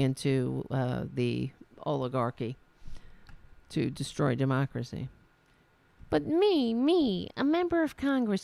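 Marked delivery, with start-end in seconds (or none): 6.1-8.4 higher-pitched parody of self-important outrage; wounded self-importance, hammed up; mock indignation. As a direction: play the affronted congresswoman, mockingly. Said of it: "She's really indignant".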